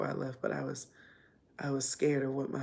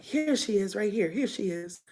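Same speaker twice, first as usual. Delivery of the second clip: calling out found her; 6 semitones higher, 6 dB louder than usual. Retold or imitated. Stepfather finds her in closet.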